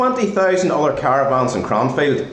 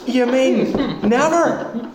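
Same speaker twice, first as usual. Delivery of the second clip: laughing disbelief; 6 semitones higher, slower than usual. Acted.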